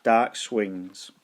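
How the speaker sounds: ordinary delivery, nothing marked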